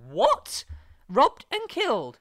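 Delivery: high, loud, stretched-out shocked cry; acting out sheer disbelief at the danger.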